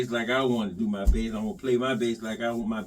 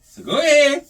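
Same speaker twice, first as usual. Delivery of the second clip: daring taunt; 14 semitones higher, 11 dB louder than usual. Acted. Taunting Gibson fans.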